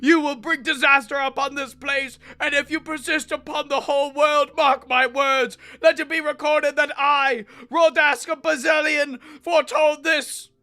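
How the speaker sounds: furious priest. Acted. Priest warning the tyrant's son of divine punishment, furious. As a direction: rail at him like a doom-saying priest.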